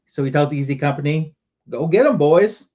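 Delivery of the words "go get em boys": louder, higher rallying order to troops; retold battle cry, raised and punchy; rallying call. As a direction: call out like a sergeant sending men in. Sergeant sends his men into combat.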